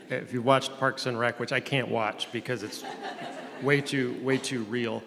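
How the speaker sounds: normal talking voice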